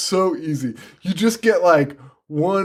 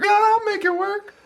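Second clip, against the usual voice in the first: far higher, breathy mock seduction; mock Sherry Moon. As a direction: camp it up as the vamp. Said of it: "Sherry Moon's like".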